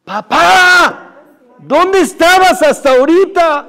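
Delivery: grito agudo y fuerte llamando a su padre; grítale "¡Papá!" al padre perdido, desbordado; grito de reencuentro. Retold or imitated. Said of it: "empieza a gritar". (el hijo le grita al padre, agudo y fuerte)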